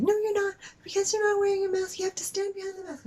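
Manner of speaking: high, rapid mocking nag; annoying woman. Cashier scolding unmasked customer, mock annoying nag. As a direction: rattle it off as a shrill-pitched parody. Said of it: "really annoying woman".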